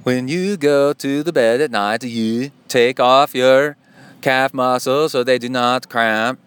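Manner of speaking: slow, hammed-up Swedish accent, "the bed" for bed; bad Swedish accent. Shown as a demonstration. Giving a foot-care tip in a deliberately bad Swedish accent. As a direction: put on a clumsy mock Swedish accent, drawn out. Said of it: "that was pretty bad".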